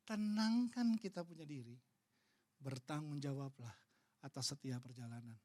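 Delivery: gently steady an upset listener; calm counsel. Retold or imitated. Menenangkan dan menasihati. (nasihat tenang dan lembut)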